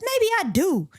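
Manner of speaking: high, agitated retort; still worked up. Acted. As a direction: answer at a high pitch, temper not yet settled. Worked-up detective answers colleague.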